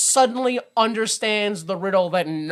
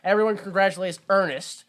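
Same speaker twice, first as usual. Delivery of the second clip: ordinary talk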